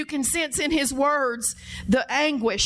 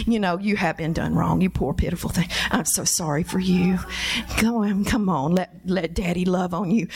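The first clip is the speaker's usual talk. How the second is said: lower, soothing mock-pity; pitying father; 6 semitones lower than usual. God imagined comforting Elijah, lower soothing mock-pity. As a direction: coo over him like a pitying daddy.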